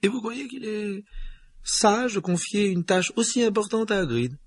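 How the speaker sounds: put-on high, tense older woman; McGonagall voice. A narrator's reading.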